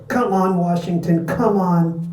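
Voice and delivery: high, urgent cheer; hollering. A narrator's reading. Seattle sports writer cheering on Washington, hollering. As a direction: holler it high, urging them on. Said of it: "began to holler".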